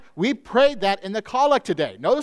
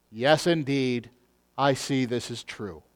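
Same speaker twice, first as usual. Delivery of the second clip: low, soft, slow and cold; cold dismissive agreement; 7 semitones lower, 5 dB quieter than usual. Acted. Preacher voices the Lord sounding like the world, coldly. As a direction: let it fall flat like a dismissal, lower and slower. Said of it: "Our Lord sounds like the world".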